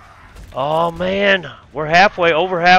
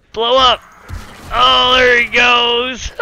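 higher, louder, drawn-out glee; savoring the explosion; delighted; 7 semitones higher, 3 dB louder, slower than usual. Real, not acted. Gamer watching the cop blow up, gleeful and drawn out.

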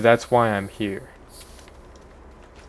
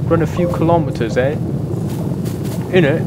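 higher, louder mock British voice, teasing; mock British accent; 6 semitones higher, 5 dB louder, faster than usual. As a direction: play a cheeky British lad. Mock British banter about kilometers.

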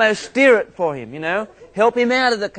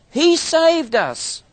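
much higher, slowed he saved us; preaching emphasis; 11 semitones higher, 4 dB louder, much slower than usual. Acted. Preacher driving home scripture, high and slow. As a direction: lift and stretch he saved us. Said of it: "emphatic preaching".

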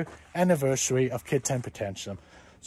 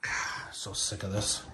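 fed-up whisper; 7 semitones lower, 11 dB quieter than usual. Acted. Dad fed up.

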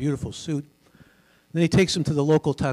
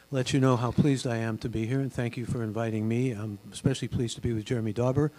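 usual speaking voice

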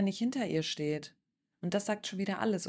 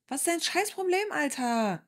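high-pitched, indignant challenge; exasperated outburst; 6 semitones higher than usual. Real, not acted. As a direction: demand an answer, thoroughly fed up. Reactor snaps at person in clip.

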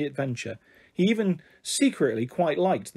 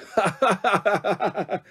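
long acted belly laugh at someone's expense; big pointed laugh; 4 semitones higher than usual. A narrator's reading. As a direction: burst into a mocking, staccato laugh. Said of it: "burst out laughing".

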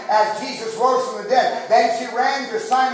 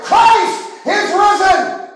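slow, much higher, loud proclaiming shout; triumphant shout; 8 semitones higher, 5 dB louder, much slower than usual. Retold or imitated. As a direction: cry it out to the whole hall. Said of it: "He shouted".

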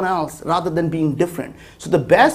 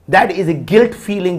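ordinary talk

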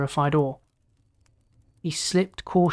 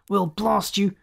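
angry threat, raised pitch; thundering threat; 4 semitones higher than usual. A narrator's reading. Captain threatens the raider on the screen, mildly thundering. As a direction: threaten with a touch of fury. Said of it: "Elderberg thundered".

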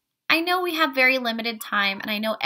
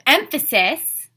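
EMPHASIS said louder to stand out; emphasized EMPHASIS; 4 semitones higher, 9 dB louder, slower than usual. Shown as a demonstration. Teacher showing children what emphasis means, EMPHASIS stressed loudly. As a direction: punch the word emphasis so it gets heard. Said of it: "I'm saying it louder".

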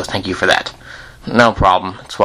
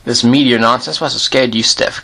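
ordinary talk

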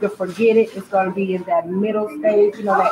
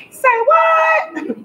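hype shout. Acted. Hyping herself on live.

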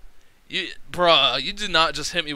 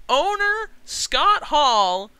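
higher, louder, slow drawn-out hype; hyped announcement; 8 semitones higher, 5 dB louder, slower than usual. Real, not acted. Gamer reading out a dream booking, slow excited hype. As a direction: savor each name like a big reveal. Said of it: "Can I say that one more time?".

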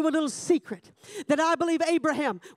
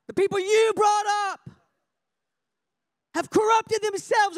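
raised, indignant declaration; angry God; 7 semitones higher than usual. Acted. God telling Moses he will destroy them, angry. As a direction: pronounce judgment in anger. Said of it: "God was so angry with them".

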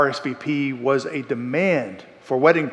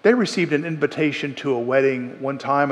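normal talking voice

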